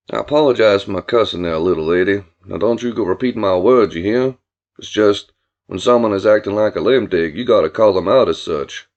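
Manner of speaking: low, loud country-man voice; folksy apologetic Billy. Acted. Billy apologising to the little girl for cussing, folksy low voice. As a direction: explain yourself with low, drawling courtesy.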